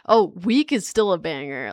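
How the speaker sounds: ordinary talk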